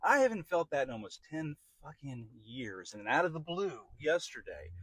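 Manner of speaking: ordinary talk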